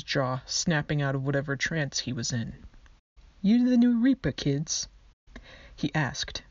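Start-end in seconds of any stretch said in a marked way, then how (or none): 3.4-4.9 skeleton in a terrible put-on foreign accent; hammed-up fake Aussie-British accent, higher and louder; obviously fake accent. A narrator's reading. Skeleton greeting new reapers in a terrible fake accent. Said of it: "in a very obviously fake accent".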